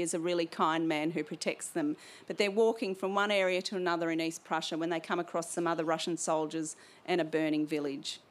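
usual speaking voice

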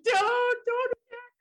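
high-pitched mock protest; half-joking cry of don't; mock plea. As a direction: plead in exaggerated alarm. Acts out own alarmed plea.

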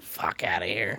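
dismissive mutter. Retold or imitated. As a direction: mutter it off under your breath. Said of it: "I'm like".

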